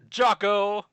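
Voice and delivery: high loud excited shout; excited call. Real, not acted. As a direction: call the name out gleefully. Calling out boss name.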